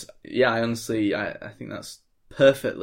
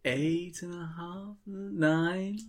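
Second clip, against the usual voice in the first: a hint of a hammed-up dithering host, higher and slow; mock hesitation; 10 semitones higher, 4 dB quieter, much slower than usual. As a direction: stretch out the dithering for comedy. Comic hesitant bit before punchline.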